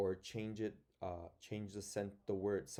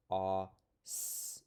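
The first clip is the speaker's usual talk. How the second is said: ordinary talk, nothing marked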